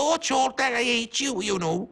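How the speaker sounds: high, mock Welsh witch whine; a hammed-up Welsh witch, high and piercing. Woman recalls the Welsh witch Fenella snapping at Chorlton, high mock Welsh. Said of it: "And she was Welsh".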